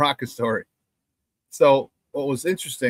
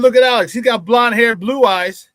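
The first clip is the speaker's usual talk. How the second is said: loud, raised-pitch retold retort; heated comeback; 6 semitones higher, 6 dB louder than usual. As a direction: call them out, re-enacting the argument. Retold ball-busting comeback to teammates.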